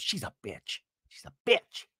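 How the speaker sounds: a hammed-up catty jab; mock Vicky voice. Vicky quoted insulting Teddi.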